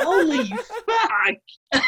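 slow, drawn out through laughter; laughing exclamation. Real, not acted. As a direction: stretch it while cracking up. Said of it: "Who has tickets on himself there?".

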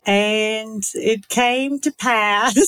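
slow Southern drawl; attempted Southern accent, drawn out. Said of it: "I can only do a southern accent".

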